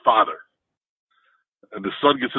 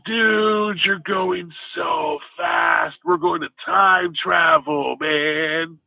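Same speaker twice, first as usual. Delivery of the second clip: higher, slow, laid-back California surfer voice; 6 semitones higher, much slower than usual. Retold or imitated. Big stoned son in the back seat greeting the driver, higher California surfer voice. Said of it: "California-ish surfer guy".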